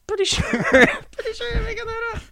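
high, loud laughing reply; laughing teasing. Real, not acted.

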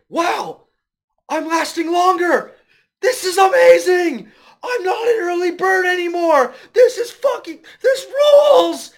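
very high, loud mock-ecstatic yelling; ecstatic shout. Friend retelling movie, voicing the dad shouting in ecstatic disbelief. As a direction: ham up the thrilled dad.

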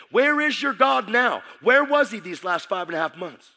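high, loud shout of disbelief; angry challenge. Retold or imitated. Friend's angry challenge about God.

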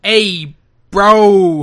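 loud, hammed-up New York greeting; exaggerated New York tough guy; mock New York accent. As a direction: bellow it like a cartoon New Yorker. Mocking the bad New York accent.

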